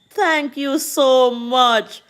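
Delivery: ordinary talk, nothing marked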